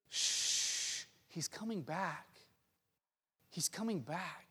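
soft, slow soothing hush; whispered reassurance, drawn out; comforting whisper. Acted. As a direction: hush a crying child gently. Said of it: "has whispered over and over again".

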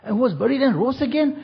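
high wondering crowd voice; amazed villagers. Retold or imitated. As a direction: gasp it out in wonder. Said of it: "and they said".

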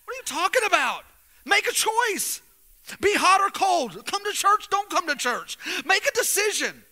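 full-force preaching. Acted. Pastor challenging the church to choose, full-force preaching. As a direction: push the room to decide.

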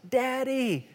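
put-on child calling. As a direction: call out like a little kid for dad. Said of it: "if I hear one of their voices from across the house".

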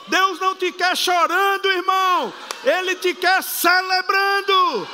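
loud, high ecstatic preaching; preaching at full force. Acted. Pastor urging church to celebrate, shouting praise. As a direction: preach at the top of your voice.

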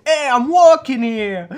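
loud slow New York shout; New York yell. Retold or imitated. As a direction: bellow it at the taxi. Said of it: "il fait". (cri new-yorkais traînant)

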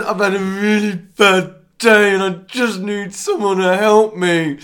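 slow, high, loud mock-sobbing plea; hammed-up blubbing, begging for help; tearful wail. Weeping customer begging the ticket clerk for help, tearful. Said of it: "I burst into tears".

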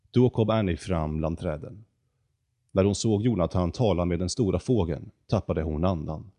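usual speaking voice

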